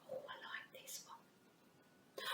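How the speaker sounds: slow, hushed whisper; whispered aside. Acted. Teacher whispering aside to Mister Wizard. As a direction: confide it softly.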